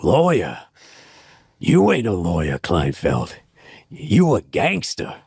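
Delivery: low raspy movie-gangster growl, punched line endings; Pacino. Acted. Quoting a favourite film line to the host, Pacino impression. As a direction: do a drawling tough-guy movie impression. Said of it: "I just love that line, Pacino".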